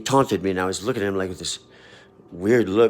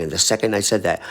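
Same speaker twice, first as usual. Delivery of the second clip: ordinary talk, nothing marked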